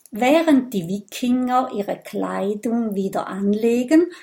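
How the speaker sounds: ordinary delivery, nothing marked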